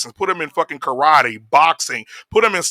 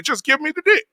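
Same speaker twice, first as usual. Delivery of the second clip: much higher teasing parody; mock quote of the singer; 11 semitones higher than usual. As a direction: toss it off as a mocking echo. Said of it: "she's saying that".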